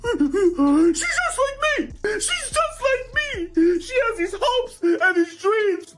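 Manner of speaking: high-pitched mock sobbing, drawn out; hammed-up crying. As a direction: imitate yourself bawling in tears. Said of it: "But I was in tears, man".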